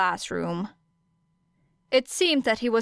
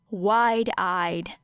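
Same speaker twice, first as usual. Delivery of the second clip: ordinary delivery, nothing marked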